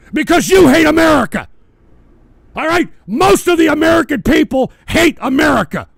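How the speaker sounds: loud, high, furious shouting; angry shouting rant. Real, not acted. Host berating his audience, shouting furiously. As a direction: scream it at them in real fury.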